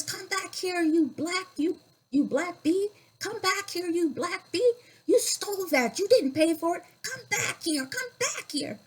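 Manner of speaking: high-pitched furious yell, imitating the shopkeeper; retold yelling. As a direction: shriek it like an angry man chasing a little girl. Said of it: "he just kept yelling at me".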